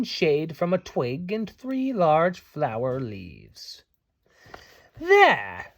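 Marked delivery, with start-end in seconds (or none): 5.0-5.7 higher, pleased little fairy exclamation; proud of a job done; bright done-it fairy; satisfied. A narrator's reading. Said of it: "she said".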